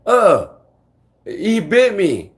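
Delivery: high, loud mock outcry of pain; imitated loud cry. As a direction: call it out like the accusing man. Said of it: "And he says it out loud".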